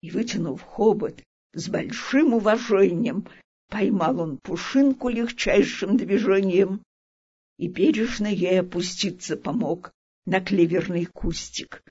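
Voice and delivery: plain reading voice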